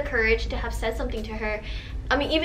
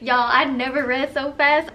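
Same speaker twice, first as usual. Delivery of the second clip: ordinary talk, nothing marked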